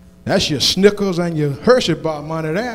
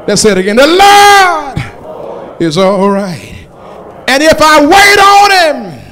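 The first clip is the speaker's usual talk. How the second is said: preaching at full force; 3 semitones higher, 6 dB louder, slower than usual. Acted. Preacher rallying the congregation, full-force whooping sermon.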